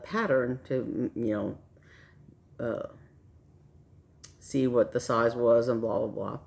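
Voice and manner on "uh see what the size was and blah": usual speaking voice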